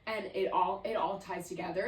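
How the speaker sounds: normal talking voice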